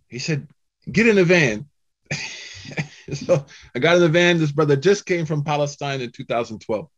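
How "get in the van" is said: high, loud, cheerful order; firm offer. Retold or imitated. Van driver tells the stranded speaker to get in, loud and firm. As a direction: insist kindly, leave no room to refuse. Brother insists.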